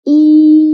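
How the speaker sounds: E as a long, loud, level ee; sustained e sound. Shown as a demonstration. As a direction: hold E steadily before rounding the lips. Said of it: "Now try with me".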